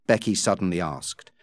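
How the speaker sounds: usual speaking voice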